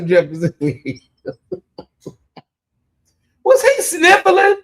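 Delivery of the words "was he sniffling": high-pitched, incredulous question. Real, not acted. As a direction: squeal it in scandalized disbelief. Mock disbelief at a man crying.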